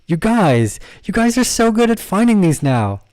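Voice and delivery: high, loud, delighted praise; real excitement, pitched high and loud. Real, not acted. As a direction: gush proudly over your students. Delighted by students' chart finds.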